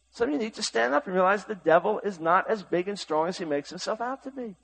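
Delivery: normal talking voice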